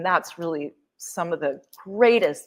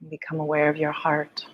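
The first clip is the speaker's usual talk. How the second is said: guided meditation; 4 semitones lower than usual. Acted. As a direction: ease them into stillness, gently.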